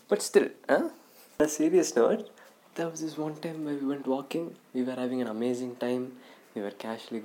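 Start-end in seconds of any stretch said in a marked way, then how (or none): none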